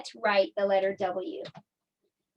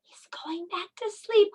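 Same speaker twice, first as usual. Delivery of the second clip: hushed whisper; 8 semitones higher than usual. Pretend. Teacher watching the pretend bear doze off, hushed whisper. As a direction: whisper so the bear stays asleep.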